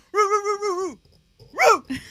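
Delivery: very high howling bark; dog barking. Retold or imitated. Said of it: "He goes".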